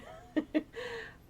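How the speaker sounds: a short, high, breathy giggle; little embarrassed giggle at herself; light laugh. Real, not acted. Laughs at her own emotion.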